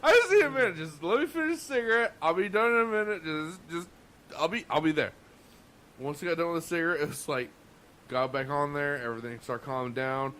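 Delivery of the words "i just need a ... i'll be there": high, strained plea, half laughing; pleading for a minute. Retold or imitated. Cook begging the manager for a minute, high and strained. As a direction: beg for a moment, voice pitched way up. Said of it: "I'm like".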